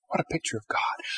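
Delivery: whispered "What a picture of God." with hushed wonder; awed whisper. Shown as a demonstration. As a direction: breathe "What a picture of God." almost to yourself. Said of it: "repeated as whisper".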